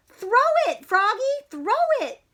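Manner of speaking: high loud team scream. Acted. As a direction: scream it urgently. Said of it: "screamed the Giants".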